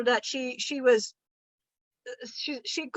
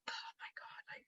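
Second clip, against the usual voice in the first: breathy unvoiced aside; hushed oh my God; exasperated whisper; 25 dB quieter than usual. Real, not acted. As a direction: whisper it to the audience in disbelief.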